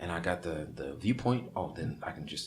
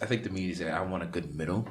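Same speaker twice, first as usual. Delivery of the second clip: normal talking voice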